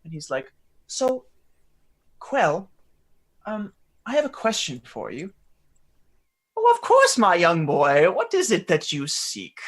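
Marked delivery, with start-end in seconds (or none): 0.9-5.3 put-on hesitant, higher-pitched young boy; a tentative lift in pitch, with small pauses; Ulrich. Boy with a question for his mentor, hesitant. 6.6-9.6 grand, welcoming mentor voice; Quell. Acted. Mentor answering his young boy's question, theatrical and obliging.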